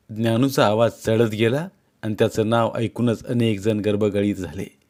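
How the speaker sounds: plain reading voice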